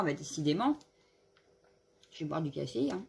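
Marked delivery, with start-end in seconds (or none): 2.1-3.0 throat catching after coughing; clearing a tickly throat; frog in throat. Real, not acted. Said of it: "J'ai un truc dans la gorge".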